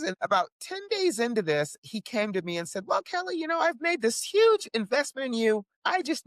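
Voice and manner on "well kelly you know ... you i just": lightly voiced high-pitched wheedler; mock a publisher buttering someone up; publisher's pitch. Publisher asking his author for money, lightly mocked pitch.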